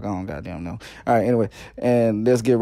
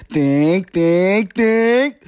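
lightly voiced cartoon kid, rising higher each time; Jimmy Neutron; 6 semitones higher, 4 dB louder, much slower than usual. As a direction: build it up like a cartoon brainstorm, louder and higher. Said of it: "Jimmy Neutron".

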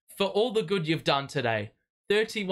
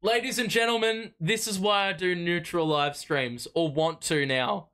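usual speaking voice

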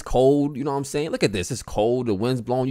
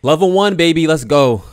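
hype; 6 semitones higher, 10 dB louder than usual. Real, not acted. Hyping himself up to start.

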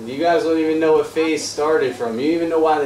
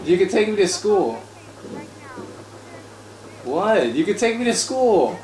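pleading; 6 semitones higher than usual. Pretend. Pleading with game girl.

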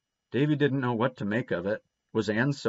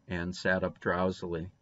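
plain reading voice

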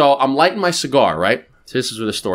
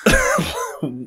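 baffled what; 11 semitones higher than usual. Retold or imitated. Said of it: "I'm like".

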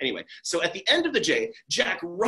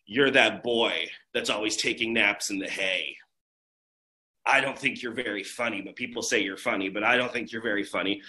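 put-on low, deadpan bored girl; flat grumpy girl; 10 semitones lower than usual. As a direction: grumble it like a girl who never laughs.

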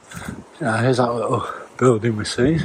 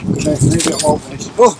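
ordinary delivery

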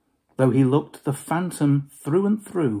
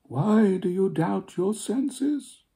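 slow ghostly question; 7 semitones higher, slower than usual. Acted.

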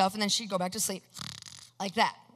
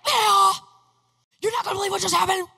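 much higher, louder, rushed excited shouting; freaking out; 9 semitones higher, 4 dB louder than usual. Retold or imitated. Son bursting out to his father about the sign, shouting excitedly. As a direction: blurt it out, overwhelmed with excitement. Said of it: "Of course, I'm freaking out".

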